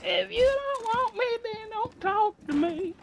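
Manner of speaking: high, slow, dopey put-on voice; teasing a friend on the phone in a silly high dopey voice; goofy dopey voice. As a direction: talk like a dim cartoon goof to tease.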